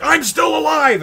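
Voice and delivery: high, drawn-out shout at full force; defiant yell. Retold or imitated. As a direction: yell it out in defiance, stretching the words. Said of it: "she goes and she yells".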